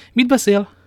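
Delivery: high, loud startled question; astonished question. Acted. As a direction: blurt it out in disbelief. Startled question at Athos's remark. (megdöbbent, hangos kérdés)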